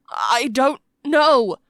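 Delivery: loud, slow, high-pitched plea; desperate denial. A narrator's reading. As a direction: draw the words out in helpless distress. Toby pleads under interrogation.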